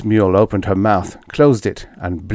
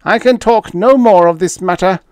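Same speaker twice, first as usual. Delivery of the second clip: high, louder distressed alien voice; 11 semitones higher, 7 dB louder than usual. A narrator's reading. Fifth refusing to talk further, distressed. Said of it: "Fifth's mandibles quivered in distress".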